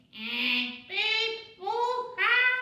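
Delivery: high robot beeps; robot noises. Pretend. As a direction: beep like a silly robot. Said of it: "pretend we're a robot".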